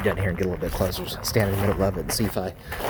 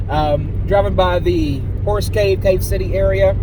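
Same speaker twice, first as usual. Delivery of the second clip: normal talking voice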